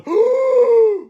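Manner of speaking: high sharp cat yowl. Acted. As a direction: screech like an angry cat. Cat yowls at chickens.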